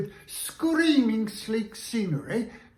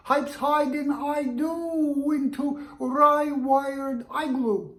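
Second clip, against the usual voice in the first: pitched up high, bouncy; high sing-song voice; 6 semitones higher than usual. Shown as a demonstration. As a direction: lift the voice high and light. High voice for twister.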